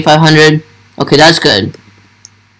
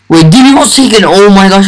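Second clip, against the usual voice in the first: excited, higher-pitched disbelief; 3 semitones higher, faster than usual. Real, not acted. Fan recognising favourite YouTuber's name, excited. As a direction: blurt it out, thrilled and higher.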